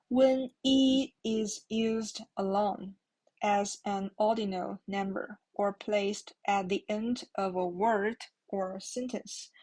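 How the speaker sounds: ordinary delivery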